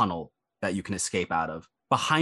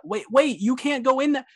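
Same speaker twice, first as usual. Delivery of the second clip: high, louder panicked shout to stop them; young man shouting; 4 dB louder than usual. Acted. Young drow man at the door, shouting at the intruders in alarm. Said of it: "voice of that young drow man from earlier shout".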